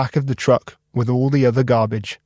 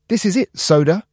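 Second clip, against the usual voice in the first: high, eager and hopeful; bright anticipation, voice lifted high; excited; 7 semitones higher than usual. A narrator's reading. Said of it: "Cap said".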